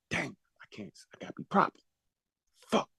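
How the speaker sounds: breathless unvoiced whisper to himself; whispered aside. Real, not acted. Muttering to himself on stage, whispering. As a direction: whisper it under your breath so no one hears.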